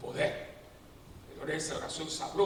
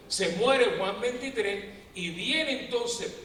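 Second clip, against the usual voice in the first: slow, louder preaching; 4 semitones higher, 5 dB louder, much slower than usual. Acted. Priest telling church history, slow and loud. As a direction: lean into the story slowly.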